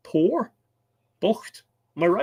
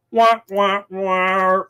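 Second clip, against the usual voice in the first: high, loud mock trombone wail; imitated sad trombone, high and held; sad trombone "wah wah wah"; 6 semitones higher, 4 dB louder, much slower than usual. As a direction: wah it out like a failure jingle. Voices a mocking sad trombone comment.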